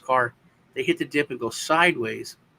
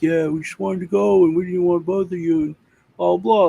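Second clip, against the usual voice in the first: friend's excuses; 5 semitones higher, 6 dB louder than usual. Retold or imitated.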